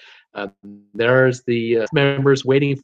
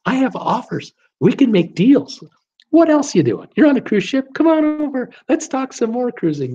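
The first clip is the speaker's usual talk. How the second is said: much higher, eager sales-pitch voice, hammed up; cruise sales consultant; 9 semitones higher than usual. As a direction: beckon passers-by in like a keen salesman. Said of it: "And they're going".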